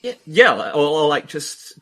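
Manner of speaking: usual speaking voice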